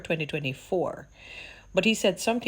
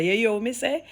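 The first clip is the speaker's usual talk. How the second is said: mock challenge; 6 semitones higher, 4 dB louder than usual. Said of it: "Him say to the reporter".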